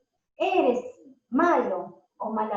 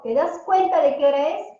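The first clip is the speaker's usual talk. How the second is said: louder, a sharp reproach about the hour; exasperated parent; 2 semitones higher, 6 dB louder than usual. Shown as a demonstration. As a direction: scold a child for staying up late.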